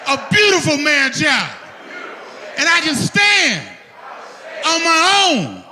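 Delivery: shouted high, leading the crowd; loud call-and-response. Shown as a demonstration. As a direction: call it out big for them to echo. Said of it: "repeat after me and make it loud".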